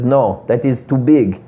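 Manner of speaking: slow, lightly imitated Russian accent. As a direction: Dimitri correcting him, lightly Russian. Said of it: "And he says".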